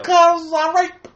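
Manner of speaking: very high, loud, drawn-out impression; hammed-up high comic answer; Bill Cosby. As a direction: blurt it slowly in an exaggerated celebrity voice. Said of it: "Why would he talk like that?".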